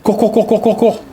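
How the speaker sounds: high, urgent cours repeated; panicked urging to run; being chased. Real, not acted. Fleeing enemy in game.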